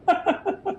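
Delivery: high-pitched put-on laughter; showy laugh. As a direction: laugh high for your companions' benefit. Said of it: "looks over at his accompaniers and he's like".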